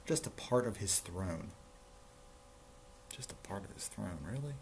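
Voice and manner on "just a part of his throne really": soft, incredulous echo, rising on "really"; hushed aside, questioning the story; quiet disbelief. Real, not acted. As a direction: repeat it under your breath, unimpressed. Reader repeats the line in disbelief.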